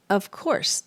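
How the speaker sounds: ordinary delivery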